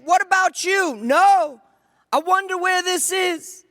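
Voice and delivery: high-pitched calls between strangers; calling out. Acted. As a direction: call across the dark, voice lifted high. Strangers calling across the river.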